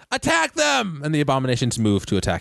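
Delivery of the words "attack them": high-pitched battle cry; yelled command. Acted. Game master voices cultist ordering monsters to attack, high yell. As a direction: cry out an order in a much higher voice.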